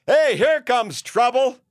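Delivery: hammed-up hotel clerk hollering at a guest; Messy Jessie greeting. Hotel clerk Messy Jessie greets an arriving guest, loud and high. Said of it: "she'll say".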